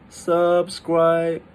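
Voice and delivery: "subscribe" drawn out slow on a high level pitch; slow, robot-like high monotone on "subscribe"; weird all-first-tone. Shown as a demonstration. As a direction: hold both syllables of "subscribe" high and even. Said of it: "say it in a weird way".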